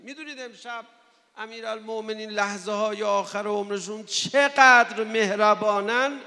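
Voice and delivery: loud, high, intense sermon climax; preaching at full force. Acted. Preacher recounting Ali waking his killer, loud fervent. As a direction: drive the story home at full force.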